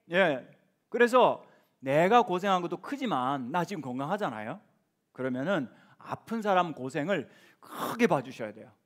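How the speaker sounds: normal talking voice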